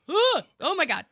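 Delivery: high, loud overwhelmed gasp. Retold or imitated. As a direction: react like the week is too much. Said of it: "sometimes go".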